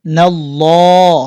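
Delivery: নাল্লাহ drawn out very slowly with a full, heavy l; heavy recitation. Shown as a demonstration. Teacher demonstrating নাল্লাহ slowly for students. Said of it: "এখানের হাটাও সাকিন হবে".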